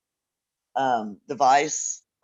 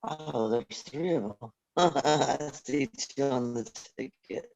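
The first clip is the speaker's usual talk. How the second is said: choppy, dropping-out phone line; breaking up; 7 dB quieter, slower than usual. Real, not acted. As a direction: voice cutting in and out on a bad connection. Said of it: "You're breaking up a little bit on our communication".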